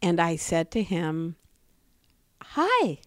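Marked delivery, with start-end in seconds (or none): 2.4-3.0 pointedly cheerful hello, higher; cheerful greeting. Retold or imitated. Jogger greeting the hostile neighbor instead of avoiding him, mock cheerful. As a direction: greet him with deliberate sunny friendliness. Greeting the angry man brightly.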